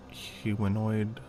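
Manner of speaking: normal talking voice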